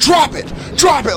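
high sharp yell of drop it; yelling at the dog to drop the squirrel, shouting. Retold or imitated. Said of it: "like that".

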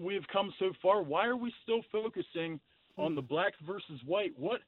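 usual speaking voice

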